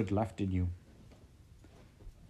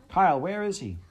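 high, loud desperate demand; 10 semitones higher, 7 dB louder than usual. Acted. Heather demanding Kyle tell her, desperate. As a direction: force the answer out of him.

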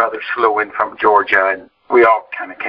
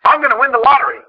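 excited dad; 14 semitones higher, 3 dB louder than usual. Retold or imitated. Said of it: "he said".

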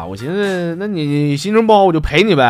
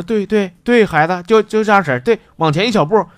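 a lightly voiced old man, higher and eager; old attendant coaching; 7 semitones higher than usual. Old attendant watching the narrator pee, lightly voiced eager coaching. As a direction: coax like a fussy old attendant.

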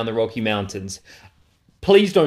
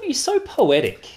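teasing; 12 semitones higher, slower than usual. Real, not acted. Teasing friend, high slow.

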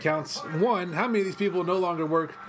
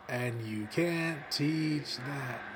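catchphrase delivery; 6 dB quieter, much slower than usual. Retold or imitated. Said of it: "I'm about to do his whole shtick".

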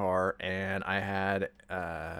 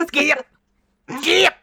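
much higher and much louder, climbing each time; giddy shout; 11 dB louder than usual. Pretend. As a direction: shriek it like an excited kid. Joking about skipping hand in hand.

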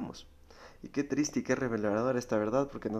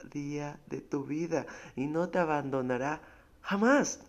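fervent preaching; 5 semitones higher than usual. Acted. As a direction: proclaim it with rising fervour. Preaching at full force. (predicación ferviente, cada vez más fuerte)

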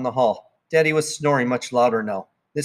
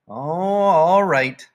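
weary give-in; 5 semitones higher, 4 dB louder, much slower than usual. Acted. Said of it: "Daddy said".

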